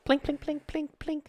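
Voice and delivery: high little plink sound effects; plinking gun noises. Retold or imitated. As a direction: mimic small shots hitting targets, way up high. Imitating gunshots at shooting range.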